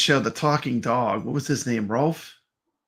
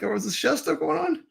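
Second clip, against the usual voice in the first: surprised; 13 semitones higher, 3 dB quieter than usual. Retold or imitated. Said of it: "I was like".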